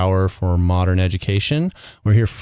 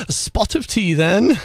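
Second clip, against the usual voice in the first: mock British accent; 9 semitones higher, slower than usual. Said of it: "barely do a British accent".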